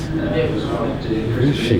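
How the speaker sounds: slow, falling inner question; drawn-out wondering. Retold or imitated. Man recalling his worry about her, slow drawn-out wondering. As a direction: stretch out the searching question, letting it fall.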